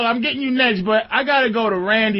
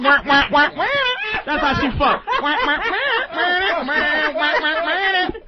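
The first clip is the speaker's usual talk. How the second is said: sung wah-wahs like a muted trombone; sad trombone wah wah; 4 semitones higher, slower than usual. Shown as a demonstration. Host singing the sad horn as a joke. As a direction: mimic the Sanford and Son sad horn. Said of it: "play the music from Sanford and Son".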